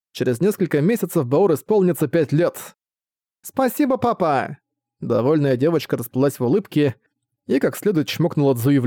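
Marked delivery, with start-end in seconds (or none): 3.4-4.6 put-on high excited child's thanks; thrilled little girl; happy little girl.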